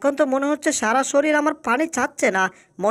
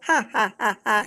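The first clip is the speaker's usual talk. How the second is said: gloating villain laugh; 5 semitones lower than usual. Acted. Fish gloats after sinking table.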